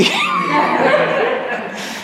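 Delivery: high, helpless burst of laughter; laughing. Real, not acted. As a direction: laugh at yourself. Laughing at own story.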